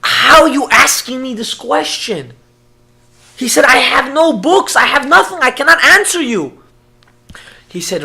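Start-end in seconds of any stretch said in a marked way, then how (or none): 0.0-2.3 loud, high, retold distress; anguished outcry. Crying rabbi is asked an impossible question, anguished outcry. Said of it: "He's shaking and he's crying". 3.6-6.6 loud, high protest of helplessness; anguished outcry. Retold or imitated. Crying rabbi without books answers the father, anguished outcry. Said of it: "He's shaking and he's crying".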